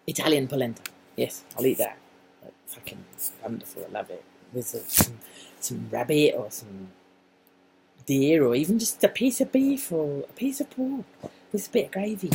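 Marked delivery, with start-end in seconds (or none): none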